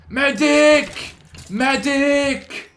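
yelling Medic, high and loud; frantic call for a medic; man down shout. Pretend. Calling for help mid-fight.

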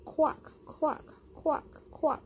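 squeaky high "quack"; high duck quacks. Shown as a demonstration. Teacher voicing the duck for children, high quacking.

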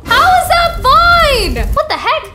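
can't believe it's called fine; outraged disbelief. Real, not acted. Kid protesting the thermometer reading, high and loud.

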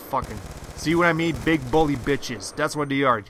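ordinary delivery

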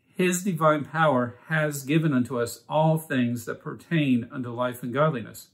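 ordinary talk, nothing marked

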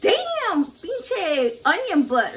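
"God damn" shot up very high, then "pinche onion butt" drawn out slowly; mock amazement. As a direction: tease mom's big booty in playful shock. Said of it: "And I'm like".

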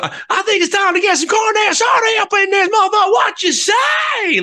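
high Southern stage shout, last words stretched; hammed-up Southern frontman hyping the crowd; Southern accent. Singer asking Texans for carne asada, Southern-accented shout. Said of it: "like, a southern".

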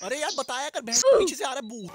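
high, loud alarmed yelling; panicked shout, voice jumps up; panicking gamer. Real, not acted. Enemy behind, yelling in alarm.

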